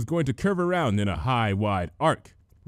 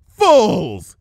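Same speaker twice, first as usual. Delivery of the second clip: loud defiant shout, higher; 8 semitones higher, 11 dB louder than usual. A narrator's reading. The changeling queen shouting at the heroes, defiant. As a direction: hurl the word out in defiance. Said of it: "the changeling shouted in defiance".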